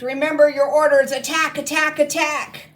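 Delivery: high, loud furious battle cry; screeching command. Acted. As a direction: scream the attack order. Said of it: "she shrieked".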